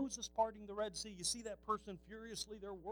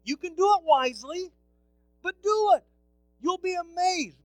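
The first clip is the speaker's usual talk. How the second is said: preaching at full force; 9 semitones higher, 18 dB louder than usual. Acted. Pastor urging his congregation, full-force preaching. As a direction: call the church to bless people.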